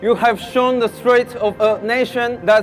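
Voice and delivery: measured speech from the podium; formal speech. Acted. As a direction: read it like an address to a crowd. Delivers a rally speech.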